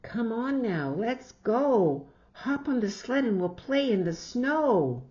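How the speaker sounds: eager call. Acted. Jillian urging Rebecca to the sled, eager. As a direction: urge her friend onto the sled. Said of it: "Jillian calls friend".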